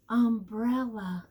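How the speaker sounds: ordinary delivery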